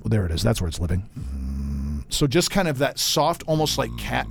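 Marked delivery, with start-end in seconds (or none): 0.0-2.0 low creaky fry demo; vocal fry. Shown as a demonstration. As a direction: let the voice crackle down low. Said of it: "let me get right on the microphone and do this".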